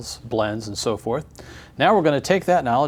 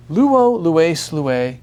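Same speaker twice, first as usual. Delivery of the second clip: louder, higher "Luo, lues", stressed on the endings; second-syllable stress; 4 semitones higher, 5 dB louder, much slower than usual. Shown as a demonstration. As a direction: chant "Luo, lues, lue" leaning on each second syllable. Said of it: "easier to accent the second syllable".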